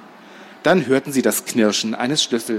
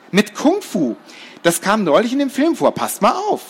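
put-on high, cocky boy voice; boastful little boy; 9 semitones higher than usual. Boy promises a kung fu escape, boastful. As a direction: show off in a high voice.

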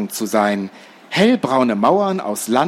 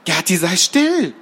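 startled hiss; 6 semitones higher than usual. Acted. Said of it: "zischte Laura erschrocken".

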